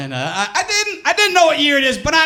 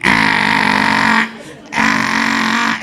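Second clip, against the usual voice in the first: very low slow drawn-out groan; low mock machine groan. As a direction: imitate the terrifying rumble of the machine. MRI tech mimics scary machine.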